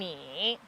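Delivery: 你 drawn out in a dip and lift; third tone. Shown as a demonstration. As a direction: say 你 slowly with a falling-then-rising tone. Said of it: "你 is the third tone".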